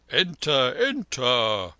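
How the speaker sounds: loud welcoming call; cried out. Acted. Moneylender loudly calling the lama into the carriage. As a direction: call them in loudly, with an oily smirk.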